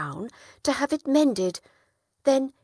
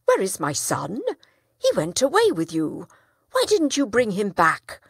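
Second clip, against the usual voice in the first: loud, accusing father demanding answers; a worried father confronting his friend, raised voice; 2 semitones higher, 7 dB louder than usual. Acted. Father demands his missing son.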